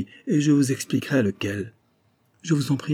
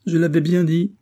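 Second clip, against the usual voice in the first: plainte aiguë et appuyée du valet; gémir sa déception, plus aigu; gémissant; 7 semitones higher, 4 dB louder than usual. A narrator's reading. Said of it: "gémit-il". (gémissement plaintif)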